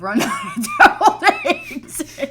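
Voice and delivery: laughing through the words, slow; cracking up while speaking. Real, not acted. Laughing at own story.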